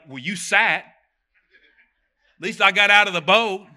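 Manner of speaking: play-acted defensive pushback; slow, pointed comeback to critics; mock retort. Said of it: "be like".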